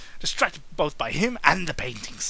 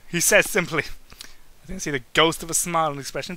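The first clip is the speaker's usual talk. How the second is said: usual speaking voice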